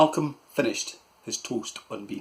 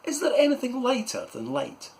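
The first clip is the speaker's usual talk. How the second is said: put-on much higher boy's voice; 13 semitones higher than usual. Malcolm asking his uncle, high child's voice. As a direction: ask it like a curious child. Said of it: "and then said".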